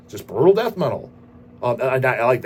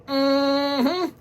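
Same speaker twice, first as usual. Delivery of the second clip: high, squeaky approving mm-hmm; a cartoonish, pitched-up nod of approval; squeaky mock approval; 13 semitones higher than usual. Host approving a fan's plans with a squeaky high mm-hmm. Reacting to a fan letter.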